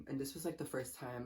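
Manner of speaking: ordinary delivery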